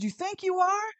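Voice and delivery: high, loud mocking question; loud high dare at the attacker; loud challenge. Confronting the person.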